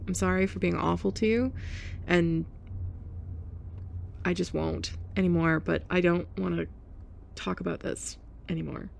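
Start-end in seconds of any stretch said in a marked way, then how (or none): none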